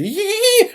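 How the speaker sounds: very high, scared little whimpering squeaks; frightened squeaks. Retold or imitated. Ten drawing the sword in front of Doppo, very high frightened squeaks. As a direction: squeak in fright like a small boy. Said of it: "apeuré".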